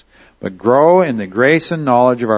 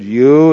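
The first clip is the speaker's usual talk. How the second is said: "you" said louder and hit hard; stress on you; 6 dB louder than usual. Shown as a demonstration. Preacher stressing "you" to his congregation, punched loud. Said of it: "is very emphatic".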